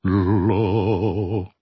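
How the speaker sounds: growled lion sound; lion roar. Pretend. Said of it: "I love my voice when I do a lion".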